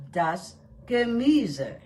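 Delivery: "Gemüse" stressed on MÜ, said slowly; slow teaching model, "Gemüse" as ge-MÜ-se; second-syllable stress. Shown as a demonstration. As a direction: lean on the middle syllable of "Gemüse". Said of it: "the stress is on the second syllable".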